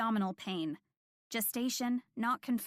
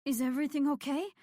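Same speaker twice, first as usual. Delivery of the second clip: quick, tense question through clenched teeth; scared patient asks the nurse, teeth gritted; 4 semitones higher, 3 dB louder, faster than usual. Acted. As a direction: push the words through a locked jaw. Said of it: "she asked with gritted teeth".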